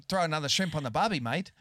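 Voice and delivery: fast Australian "throw another shrimp on the barbie"; mock Aussie cliché. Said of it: "Pull the Aussie card".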